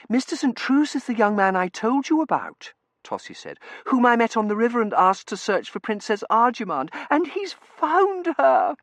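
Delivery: put-on gushing girl voice. Tossie gushing voice.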